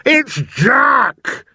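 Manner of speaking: very high, loud giant's cry; panicked bellow. A narrator's reading. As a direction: bellow the name in sheer terror. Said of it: "bellowed the flesh lump eater".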